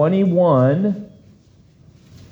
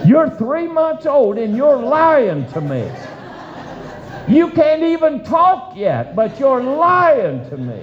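put-on higher, playful indignation at the baby; high mock outrage; 11 semitones higher than usual. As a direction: tease the baby as if scandalized. Father teasing three-month-old baby.

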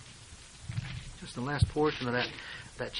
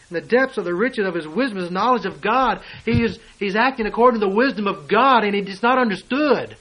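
louder, higher pulpit delivery; rising preaching; 5 semitones higher, 8 dB louder than usual. Acted. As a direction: lift it to the congregation. Preaching builds again.

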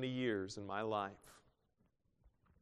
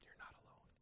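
whispered; 24 dB quieter, faster than usual. Acted. God's voice whispered on repeat.

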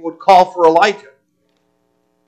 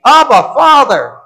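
higher and louder cry of Abba, Father; urgent appeal; 8 semitones higher, 5 dB louder than usual. Retold or imitated. Preacher voicing Jesus crying to his Father, higher and louder. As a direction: call out to the Father, half-raised.

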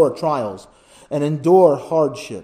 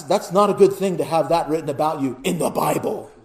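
ordinary talk, nothing marked

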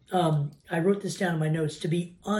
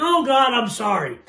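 loud, higher mock sorry; mock tossed-off apology; 5 semitones higher, 8 dB louder than usual. Man who cursed after hitting thumb, mock apology. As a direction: blurt a throwaway apology, hammed up. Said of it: "you just throw that sorry out there".

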